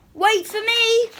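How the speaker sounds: high, loud croaky frog cry; frog calling out. Acted. Frog calls after the princess racing home. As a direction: shout after her in a tiny frog voice.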